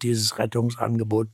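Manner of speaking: ordinary talk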